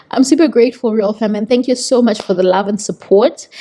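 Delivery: ordinary talk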